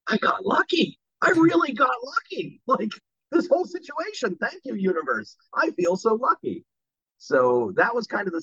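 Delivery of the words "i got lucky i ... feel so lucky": high, bright retold gush of luck; thrilled gushing. Actor recalls getting the Lucky job, gushing high. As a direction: replay the giddy joy of landing the part.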